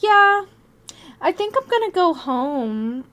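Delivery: high, drawn-out sheepish reply; slow, high-pitched self-parody; mocking own idiocy. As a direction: mimic yourself sounding foolish and sick. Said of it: "Like a fucking idiot".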